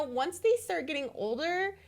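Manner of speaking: ordinary talk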